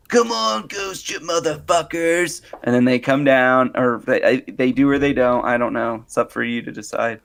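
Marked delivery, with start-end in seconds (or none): 0.1-2.4 high start, drawn-out mock challenge; taunting TV ghost hunter. Parodying every episode's host, taunting. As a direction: call out the ghosts in a hammed-up voice. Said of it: "He goes".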